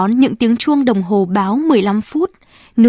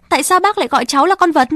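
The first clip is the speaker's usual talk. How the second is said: shouting; 6 semitones higher, much faster than usual. Acted. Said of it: "Rosalie hét".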